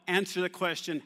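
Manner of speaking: ordinary talk, nothing marked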